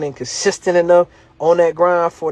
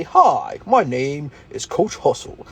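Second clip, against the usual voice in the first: mock proper intro; 3 semitones lower, slower than usual. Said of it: "I don't have to say".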